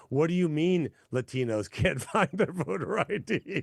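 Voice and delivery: mock a Latin American official in a heavy Spanish accent, amused; thick Spanish accent. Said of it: "in a very thick Spanish accent and go".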